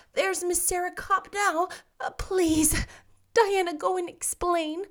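brightly voiced young heroine fretting; Anne's lively girlish voice. A narrator's reading.

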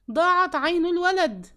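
high, loud anguished scream; a mother crying out over her wounded son; screaming at the top of her voice. A narrator's reading. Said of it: "صرخت بأعلى صوتها". (صرخة عالية مفجوعة)

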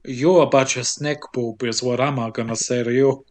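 low-pitched mock villain; hunter's character voice. Podcaster voices the hunter insulting his captives, low character voice. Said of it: "He's like".

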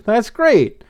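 high-pitched cheerful approval; exclaimed delight. A narrator's reading. Blythe cheers the news of moving in, exclaimed delight. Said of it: "Blythe exclaimed".

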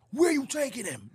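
high, indignant challenge; imitating mad Lee.

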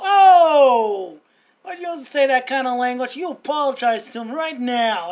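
loud shocked cry, then firm telling-off; retold scolding. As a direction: holler the first word, then scold the child. Scolding the swearing kid, retold.

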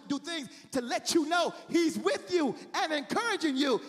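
usual speaking voice

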